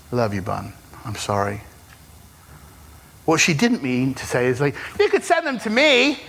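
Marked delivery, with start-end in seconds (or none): 0.1-1.6 low, soft re-enacted comforting; gently console, hushed and low; tender comfort. Husband retelling how he held his crying wife, tender and quiet. 5.0-6.2 high-pitched, comically eager suggestion; mock tactless offer. Husband joking about the wrong reply to grieving wife, mock eager.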